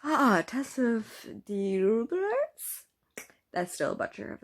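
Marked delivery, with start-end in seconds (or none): 0.0-2.8 slow, drawn-out exasperated groan; exasperated outcry. Real, not acted. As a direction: cry out in disbelief at the author. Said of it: "I'm screaming at Thomas Hardy".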